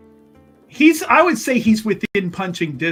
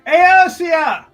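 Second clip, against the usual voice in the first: high, loud holler of a name; distant calling; 7 semitones higher, 8 dB louder than usual. Acted. Visitor calls up to woman several stories up, hollering. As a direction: call up to someone far off. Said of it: "in the far distance, you can hear".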